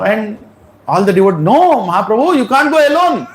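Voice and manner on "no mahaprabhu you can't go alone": urgent protest. Retold or imitated. Devotees protest his leaving.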